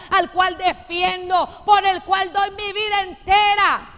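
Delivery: loud, slow, fervent proclamation; preaching at full force. Acted. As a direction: drive the promise home to the church. Preaching, voicing the Lord.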